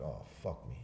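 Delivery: low, very soft retold groan of words; quiet dismay. Man learning he can't fly, low resigned dismay. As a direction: sink it under your breath. Retold reaction to flight ban.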